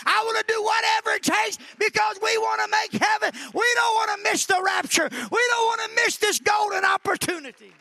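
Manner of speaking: high, loud revival shout; fervent, high-pitched pulpit cry; full-force preaching. Real, not acted.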